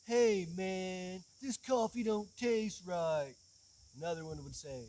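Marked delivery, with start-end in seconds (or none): 0.1-3.3 slow, stoned drawl. Retold or imitated. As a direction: slur it out like a stoner. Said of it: "sounded like [name] and [name]".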